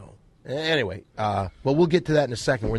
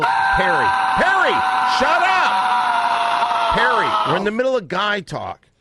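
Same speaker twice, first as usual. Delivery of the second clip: loud, much higher shout to shut him up; 9 semitones higher, 10 dB louder, slower than usual. Real, not acted. Host shouting at Perry to shut up. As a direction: yell over him to regain control.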